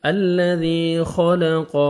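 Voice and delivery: خَلَقَ slow with a thick, heavy خا; slow tajweed drill; heavy خا and قاف. Shown as a demonstration. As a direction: make the خا in خَلَقَ full and heavy. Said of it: "خا کو موٹا کر کے پڑھیں گے".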